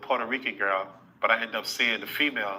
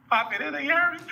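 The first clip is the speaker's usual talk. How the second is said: excited; 3 dB louder, faster than usual. Real, not acted. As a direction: voice climbing high and excited. Excited climax of retold story.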